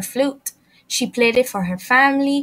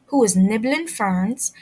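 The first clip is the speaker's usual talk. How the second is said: ordinary delivery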